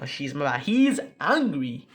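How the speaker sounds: ordinary talk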